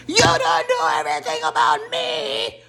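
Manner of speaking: high-pitched imitation of a screaming housewife; hammed-up shriek at the top of the voice; mock screaming. Imitating Uber arguing on the show, mock screaming. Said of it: "Uber screaming to the top of her lungs".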